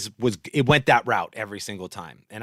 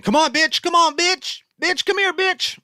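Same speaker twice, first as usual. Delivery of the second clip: very high-pitched dog-calling voice; high, loud mock pet-call; 4 dB louder than usual. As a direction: imitate yourself calling a puppy in singsong. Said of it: "I'm saying".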